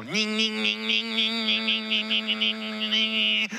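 small car engine hum; mock Geo Metro drone; imitated engine whine. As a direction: buzz it like a tiny engine.

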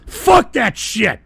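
slow, loud, every word punched; slow angry emphasis. Real, not acted. Streamer dismissing the idea in slow anger. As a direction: spit it out slowly. Rant on foreign policy.

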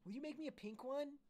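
put-on high, loud girlish squeal; pleading Rondella. Rondella wants a pink lightsaber, asking in a high squeal. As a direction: ask in an eager high-pitched gush. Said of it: "asked Rondella".

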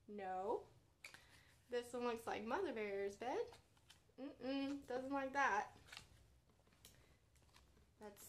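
gentle bedtime storybook read; reading to children. A narrator's reading. Children's librarian reading a pop-up book aloud, gently.

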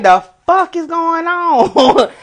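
high, drawn-out disbelief; slow mock-bewildered cry; retold astonishment. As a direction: stretch it out high, acting out how baffled you were. Retold shock at federal escort offer.

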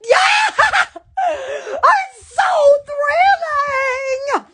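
high excited squeal, laughing; thrilled. Real, not acted. Vlogger thrilled over her new find, high squeal. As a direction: gush with delight. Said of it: "I get excited over a stop sign".

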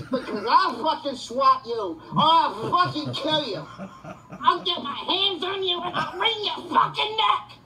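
furious threats. Real, not acted. Threatening whoever swatted him, laughing furious yell. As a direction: rage through a bitter laugh.

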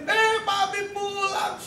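put-on high, drawn-out child's call; imitating a child's delighted cry; excited small child. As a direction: shout it like a little kid spotting daddy. Small child greets returning father.

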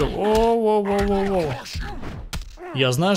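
normal talking voice

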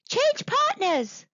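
high, drawn-out monkey call. A narrator's reading. Monkey interrupting the frantic dance, calling out.

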